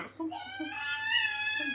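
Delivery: drawn-out call. Real, not acted. Mother calling kids to look.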